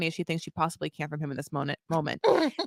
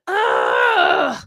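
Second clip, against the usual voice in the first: groan; 8 semitones higher, 8 dB louder than usual. Retold or imitated. Brittany groaning at Handy.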